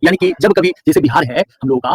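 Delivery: ordinary talk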